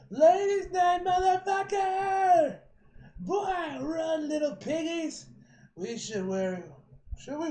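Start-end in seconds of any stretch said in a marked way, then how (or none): 0.1-5.3 high, full-volume drawn-out holler; rowdy battle yell. Acted. Attacker chasing off little piggies, taunting yell. Acted character bursts in yelling.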